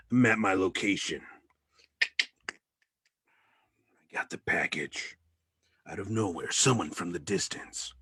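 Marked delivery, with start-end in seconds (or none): 2.0-5.2 low secret whisper. Acted. Courier whispering after grabbing the package. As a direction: hush it so nobody hears.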